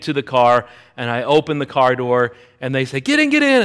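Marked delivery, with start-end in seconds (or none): 3.0-3.6 high, louder mock-panicked urging; urgent plea. Girls to the driver from inside the car, frantic. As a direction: imitate a frantic plea, pitched way up.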